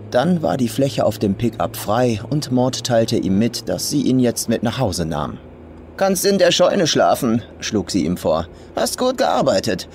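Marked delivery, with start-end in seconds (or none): 6.0-9.9 put-on rough old farm woman. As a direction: sneer it as the crude old farmer.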